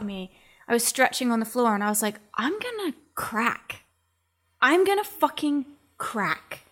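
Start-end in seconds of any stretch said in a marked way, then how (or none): 2.3-6.6 high, slow, strained retelling of despair; near breaking. Retold or imitated. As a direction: voice pinched and drawn out, about to crack. Retold breakdown after boxing.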